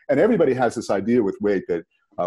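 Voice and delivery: ordinary talk, nothing marked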